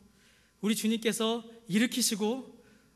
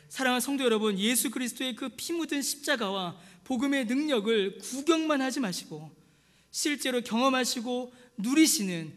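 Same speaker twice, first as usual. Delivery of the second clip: raised, urgent sermon exhortation; fervent preaching; 4 semitones higher, 3 dB louder than usual. Acted. Pastor urging the congregation, fervent raised preaching. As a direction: plead with the congregation, rising in force. Preaching builds to full force.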